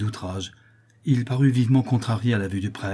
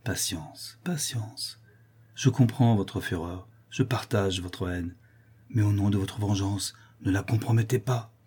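hushed, compassionate calming; calm a furious man gently; kindly soothing; 4 dB quieter than usual. Acted. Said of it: "reprit Rodolphe avec bonté".

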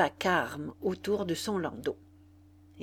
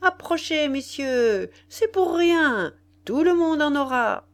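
barker's patter; 7 semitones higher, 5 dB louder than usual. A narrator's reading. La Faloise hawking champagne to the crowd, barker's patter. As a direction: reel them in like a street hawker. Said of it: "lançait un boniment".

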